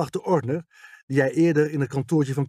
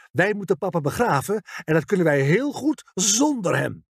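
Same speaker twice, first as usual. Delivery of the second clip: angry, higher-pitched and emphatic; woedend; 6 semitones higher than usual. A narrator's reading. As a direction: hit the last words with indignant force. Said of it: "Haar ogen stonden woedend". (fel en boos, met verhoogde stem)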